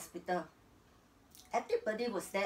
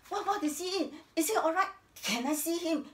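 urgent, high-pitched questions; anxious wife; 7 semitones higher, 4 dB louder than usual. Acted. Wife asking after her sick husband at the hospital, anxiously. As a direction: ask in a rising panic.

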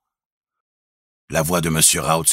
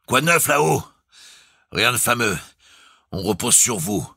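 high, raised voice down a speaking tube; calling out; 8 semitones higher, 3 dB louder than usual. A narrator's reading. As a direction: call down to the engine room. (appel à voix haute dans le porte-voix)

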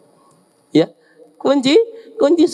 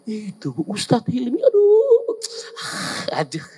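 mock shocked cry. Gossiper names ustadz, cry.

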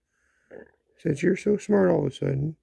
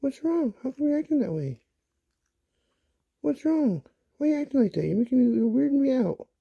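high, coaxing pet voice; a worried owner coaxing her puppy; pet talk; 6 semitones higher than usual. Real, not acted. Owner asks her puppy what's wrong, high coaxing pet voice.

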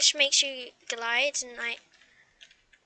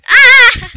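loud frightened scream; panicked scream; 8 semitones higher, 16 dB louder than usual. Real, not acted. Dragon catches her.

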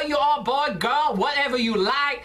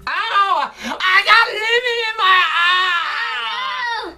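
loud, high mock wail; hammed-up cry of agony; faked pain; 6 semitones higher, 6 dB louder, much slower than usual. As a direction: yell as if your eye is stinging. Fakes pain from lemon in eye.

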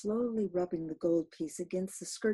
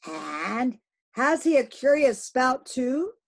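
loud, slow eager question; harpooner Dagu; 6 semitones higher, 10 dB louder, slower than usual. Acted. Dagu asks the captain about the spout. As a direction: ask it with weight.

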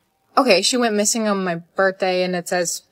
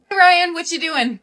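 high, bright-pitched holler of greeting; calling out; 8 semitones higher than usual. Acted. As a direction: call across to someone busy. Calling out to a classmate.

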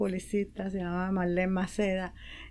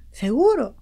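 anxious check; 4 semitones higher, 7 dB louder than usual. Retold or imitated. Mother doubting son.

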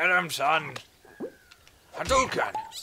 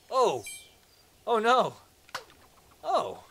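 startled cry; 7 semitones higher, 5 dB louder, slower than usual. Real, not acted. Mishap in the game.

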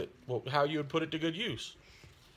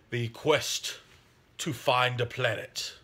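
mock epic narration; 3 semitones lower, slower than usual. Dramatic narrative intro, "dramatic music.

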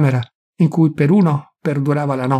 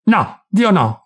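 higher, louder cry of refusal; shouted protest; 6 semitones higher, 4 dB louder, much slower than usual. Acted. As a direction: cry out against the priest's words. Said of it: "gridò Carlo Naldi". (grido di protesta, più alto e più forte)

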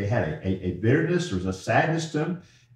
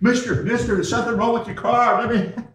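much higher and louder, breathless urgency, ending in a laugh; urgent calling out; 12 semitones higher, 7 dB louder than usual. Pretend. Mechanic running into the street after the preacher's car, high and loud. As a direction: call after him like a frantic mechanic.

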